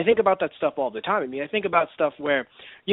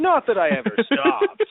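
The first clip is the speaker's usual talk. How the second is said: higher-pitched playful repeat; amused, raised-voice mock insistence; joking insistence; 8 semitones higher, 4 dB louder than usual. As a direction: push it up high for comic protest. Joking repeat after both laugh.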